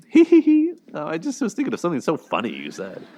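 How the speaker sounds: shrill high giggle, then a fast sing-song jab; mock a sneaky boyfriend tittering at his own joke; high, loud fake giggle sliding into a quick snide remark; passive aggressive mock. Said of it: "he's so passive aggressive".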